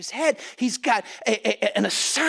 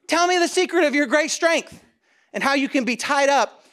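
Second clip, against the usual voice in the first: loud, higher-pitched open demand; brazen Delilah; 7 semitones higher, 4 dB louder than usual. Acted. Delilah demanding Samson's secret, brazen and loud. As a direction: push the line out loudly as a bold temptress.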